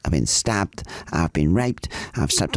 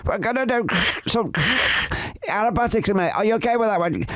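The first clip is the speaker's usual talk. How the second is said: high-pitched imitation of a pilot calling out; acted-out pilot, voice pitched way up; retold pilot's call; 13 semitones higher than usual. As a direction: call it up high, as if over the engine. Retold pilot announcing aerobatics mid-flight.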